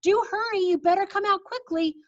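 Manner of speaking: high urgent call; calling grandmother. Acted. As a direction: urge him out, pitched high. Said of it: "she shouted".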